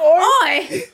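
loud, high cartoon English "Oi"; mock British oi. Teasing friends with a fake English shout. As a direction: bark "Oi" like a London lad.

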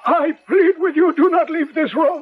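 urgent high pleading. Acted. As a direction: hold him back urgently. Struggle to keep doctor in bed.

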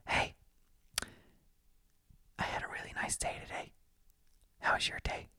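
soft breathy ASMR whisper, mocking. Mocking an ASMR girl whispering to the viewer. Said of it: "some chick going like".

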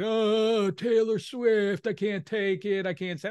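drawn-out mock whine of a complainer; put-on, dragged-out grumbling; mock complaining. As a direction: imitate someone moaning about a pop star. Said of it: "You just want something to complain about".

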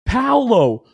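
roll-call the heroes at full shout; excited shouting. Real, not acted. Hyping the players after a win.

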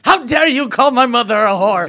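high, loud comic indignation; mock outrage. Fighter taking a lunch invite personally, hammed-up outrage. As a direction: overreact as if gravely insulted. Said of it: "he takes things personally".

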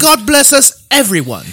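put-on high, loud, squeaky Dickensian British accent; hammed Tiny Tim-style British voice. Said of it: "you can do the Dickensian".

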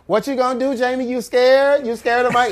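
high-pitched imitation of women at a table; mock female taunt; taunting girls. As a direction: pitch it way up, goading.

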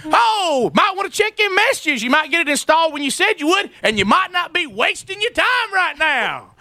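husband mocks the installer who wasted his Saturday; sarcastic mock sweetness. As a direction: rub it in with fake politeness. Mocking the late installer.

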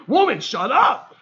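loud, high plea to stop; hammed-up exasperation, pitch jumping up; exasperated mock yell. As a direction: act out an imagined outburst at your wife. Imagined outburst at his wife.

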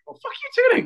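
very high-pitched indignant yelp; mock angry comeback. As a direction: squawk back at the kid in mock offence. Retold comeback to his daughter.

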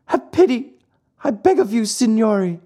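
high, pleading puppet voice; small high-pitched supplicant; pitiful. A narrator's reading. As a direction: beg pitifully for mercy. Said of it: "asked in a pitiful voice".

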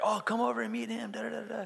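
high, light mock-friendly chatter; imitated junior high pastor. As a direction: wave someone over in a put-on high voice. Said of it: "and he's like".

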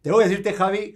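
high and loud, thrilled to reveal the guest; an excited host shouting the guest's arrival; excited announcement. Real, not acted. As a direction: burst out with the big news.

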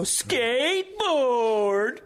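high, long drawn-out taunt; sing-songy sarcastic. Retold or imitated. Teen heckling a skateboarder from a car, sing-songy sarcastic. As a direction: stretch it into a mocking singsong. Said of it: "in a real sing-songy, sarcastic tone".

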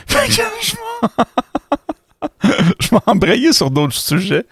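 laughing through the words. Real, not acted. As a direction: talk while chuckling. Laughs at own rambling.

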